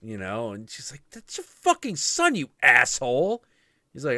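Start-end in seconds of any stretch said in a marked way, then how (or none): 1.1-3.4 very high, loud enraged scream; mother screaming at Shiva about her son; furious scream. Retold or imitated. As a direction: shriek it at him. Parvati screaming.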